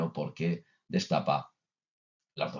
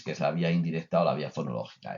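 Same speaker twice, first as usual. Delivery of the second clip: ordinary delivery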